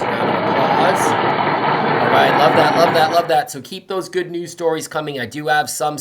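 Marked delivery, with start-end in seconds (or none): 0.0-3.5 hyped call for applause. Real, not acted. Principal rallying students for applause, loud and excited. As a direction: pump up the whole school for applause.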